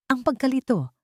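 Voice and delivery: ordinary delivery, nothing marked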